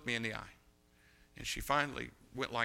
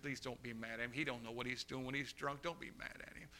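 soft plea; 11 dB quieter than usual. Retold or imitated. Girl pleading for father.

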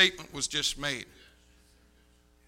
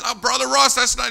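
loud, raised-pitch objection; mock protest; 8 semitones higher, 10 dB louder than usual. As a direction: object loudly in a high voice. Voicing friend's laughing protest.

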